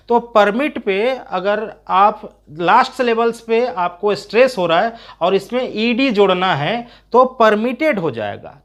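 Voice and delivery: ordinary talk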